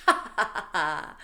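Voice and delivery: loud evil cackle; villain laugh. Pretend. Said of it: "Would you like my villain laugh?".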